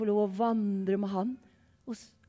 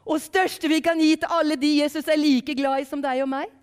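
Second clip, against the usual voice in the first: higher, louder heartfelt proclamation; preaching; 6 semitones higher, 7 dB louder than usual. Acted. Preacher telling of Jesus' love for all, raised preaching voice. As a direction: proclaim it in one sustained raised line.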